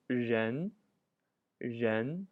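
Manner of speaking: Zhen said with a rising second tone. Shown as a demonstration. Teacher modeling a new word for learners, careful rising tone. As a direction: model "Zhen" slowly, pitch climbing. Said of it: "the second tone".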